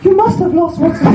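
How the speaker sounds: badly faked woman's voice, very high; comically high, unconvincing goddess; Freya. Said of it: "someone trying to imitate a woman".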